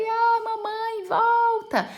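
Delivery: put-on very high, slow childlike wail; aunt playing the abandoned daughter, crying for her mother; pretend crying. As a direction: wail like a little girl left alone. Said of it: "Eu comecei a chorar".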